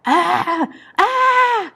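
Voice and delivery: high-pitched acted scream; two high cries of pain. A narrator's reading. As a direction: cry out in pain, very high, narrator's imitation. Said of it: "More screams of pain".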